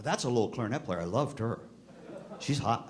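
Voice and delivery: ordinary talk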